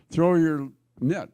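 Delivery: loud, slow call across the water. Retold or imitated. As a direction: call it out to the boat from shore. Jesus calls to boat from shore.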